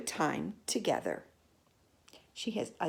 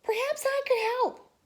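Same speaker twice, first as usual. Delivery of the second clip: high, light offer of help; hairdresser voice. Acted. Hairdresser volunteers to help, high piping voice. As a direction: pipe up with an offer.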